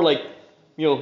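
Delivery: ordinary talk, nothing marked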